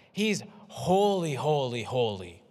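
slow, weighty HOLY three times over; each "holy" drawn out, falling in pitch; emphatic triple repetition. Acted. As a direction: stretch every "holy" for emphasis. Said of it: "they're saying".

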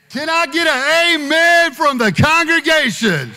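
loud and high, rousing the room; calling out loud. Real, not acted. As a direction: call for an amen. Calls for amen.

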